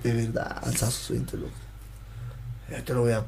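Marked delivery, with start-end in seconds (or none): 0.0-1.5 quiet. Real, not acted. Quiet admission of loss.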